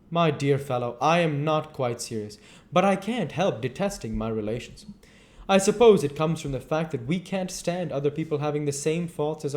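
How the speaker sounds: Lord Henry the dandy. Acted.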